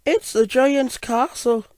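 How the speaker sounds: high-pitched little engine character; reply in a high little engine's voice; high storybook engine voice; gold engine. Acted. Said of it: "the gold engine replied".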